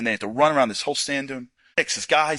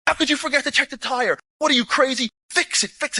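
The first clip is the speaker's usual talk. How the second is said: high-pitched exasperated yell; 8 semitones higher than usual. Retold or imitated. Officer yelling at his men to fix the tire. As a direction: tear into them like a frantic officer. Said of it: "He's yelling at his people".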